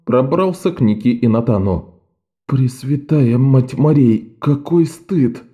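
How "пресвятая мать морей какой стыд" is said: ordinary delivery, nothing marked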